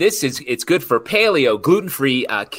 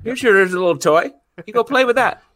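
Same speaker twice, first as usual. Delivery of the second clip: dismissive wife; 10 semitones higher, 3 dB louder than usual. Retold or imitated. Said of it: "she looks at me like".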